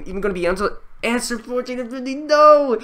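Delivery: high rush breaking into a loud NO; panicked yell. Real, not acted. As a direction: race through, then cry out in despair. Seconds left, questions unfinished.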